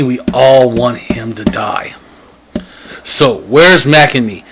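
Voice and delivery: ordinary talk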